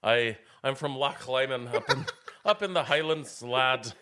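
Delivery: laughing, hammed-up Highland brogue; Scottish accent. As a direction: play a jolly Scotsman, laughing. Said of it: "Loch, yes".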